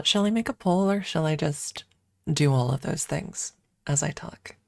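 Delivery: ordinary talk, nothing marked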